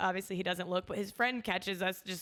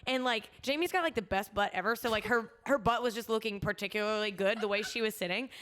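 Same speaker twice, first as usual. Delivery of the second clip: normal talking voice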